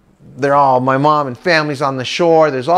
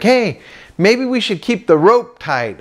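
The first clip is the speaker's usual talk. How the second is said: higher, slow, lightly voiced dad; a hint of a helpful father; dad suggesting; 6 semitones higher than usual. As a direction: float the idea slowly. Voicing dad's suggestion.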